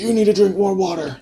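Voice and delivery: mock-bossy hydration coach; nagging partner. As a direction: push the drinks on her. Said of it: "I'm like".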